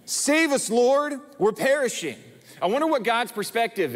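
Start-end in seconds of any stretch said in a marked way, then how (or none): 0.0-2.2 high, louder desperate cry; desperate plea. Acted. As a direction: cry out like frightened men in a storm. Disciples' plea echoed after.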